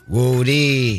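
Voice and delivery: imitated New Orleans street greeting; mock New Orleans twang; New Orleans accent. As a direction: draw out the word like New Orleans. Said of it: "New Orleans, they like".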